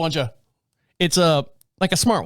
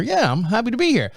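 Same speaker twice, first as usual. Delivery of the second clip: higher, happy-puppy self; eager puppy; 7 semitones higher than usual. Retold or imitated. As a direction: sound like an eager puppy dog. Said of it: "like a puppy dog".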